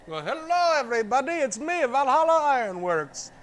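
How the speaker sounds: mock announcer voice. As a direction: puff it up like a grand self-introduction. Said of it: "I gotta do the Australian Viking accent".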